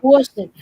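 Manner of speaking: Boston said loud as "BAW-ston"; mocked Boston accent. Repeating outsiders' mockery, Boston as "BAW-ston". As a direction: exaggerate a rounded "aw" in Boston. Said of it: "make in fun of the Boston accent".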